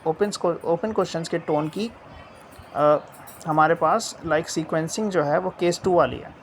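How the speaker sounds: ordinary talk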